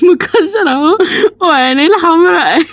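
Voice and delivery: high-pitched, laughing through the words. Real, not acted. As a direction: crack up while describing what you drew. Cracking up at her own drawing.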